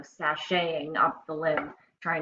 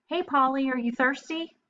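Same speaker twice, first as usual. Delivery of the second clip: higher, eager lizard call; friendly offer; 9 semitones higher, 4 dB louder than usual. Acted. Napoleon offers Polly water, eager and high. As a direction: offer the drink brightly. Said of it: "trumpet flower bird bath".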